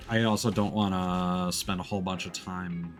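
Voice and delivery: normal talking voice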